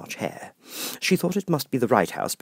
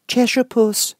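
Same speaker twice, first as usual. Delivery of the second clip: timid Alice; 7 dB louder, slower than usual. Acted. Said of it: "she began rather timidly".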